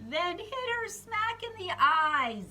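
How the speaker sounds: high, loud storyteller payoff; loud high comic reveal; excited punchline. A narrator's reading. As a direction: land the hit with a big lift. Plane hits the doctor.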